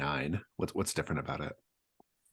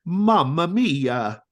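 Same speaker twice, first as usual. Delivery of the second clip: slow, higher, louder Italian exclamation; Italian pizza chef; 12 semitones higher, 11 dB louder, much slower than usual. Acted. Pizza chef exclaims in an Italian accent. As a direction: stretch out Mamma mia like an Italian chef. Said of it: "Vincenzo, the Italian pizza chef, says".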